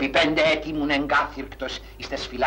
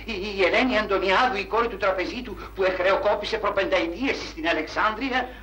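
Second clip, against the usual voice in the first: overwhelmed excitement; 9 semitones higher than usual. Acted. Cashier overwhelmed meeting banker's daughter.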